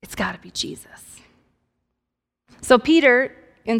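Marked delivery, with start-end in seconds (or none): 0.0-1.2 whispered realisation; hushed whisper. Acted. Disciple to Peter, eyes wide, whispering who it must be. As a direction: let it out in an awed whisper.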